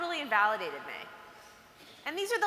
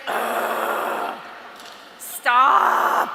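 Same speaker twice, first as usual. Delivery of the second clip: a loud, demonic snap of ugh, stop; exorcist voice; 10 dB louder than usual. Retold or imitated. Fed-up girl to a friend who frets about tests, in a loud exorcist voice. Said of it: "your exorcist voice is going really hard".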